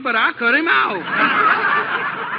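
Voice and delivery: slow, chuckling brag; a slow chuckle while bragging; laughing boast. Acted. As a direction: drag it out through a laugh.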